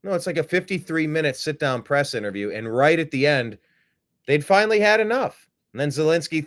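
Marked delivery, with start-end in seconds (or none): none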